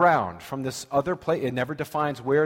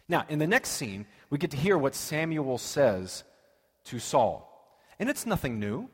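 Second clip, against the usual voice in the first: normal talking voice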